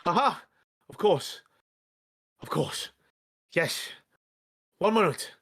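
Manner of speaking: excited. Acted. Old man eager to sell.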